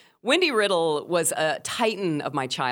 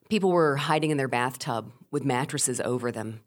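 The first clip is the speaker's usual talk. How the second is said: ordinary delivery, nothing marked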